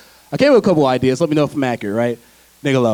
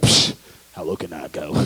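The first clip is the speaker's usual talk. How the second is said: much lower, softer scoff; scoffing low reply; 12 semitones lower, 6 dB quieter than usual. Acted. Limbo player answering the challenge, scoffing in a low voice. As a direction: drop it right down and brush off the dare. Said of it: "You're like".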